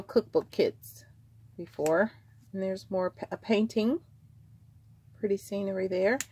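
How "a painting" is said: normal talking voice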